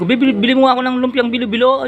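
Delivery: high, loud eager plea to buy; excited asking. Retold or imitated. Asking his wife to buy it, excited and high. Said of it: "Sabi ko".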